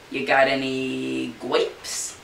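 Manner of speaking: slow, drawn-out cartoon duck. Duck asking the clerk for grapes, slow silly voice. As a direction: ask it like a dopey duck, slowly.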